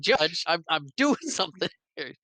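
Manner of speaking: high-pitched plea, breaking into a laugh; mock exasperated protest. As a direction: protest the interruption, amused. Lawyer protesting judge's interruption, retold.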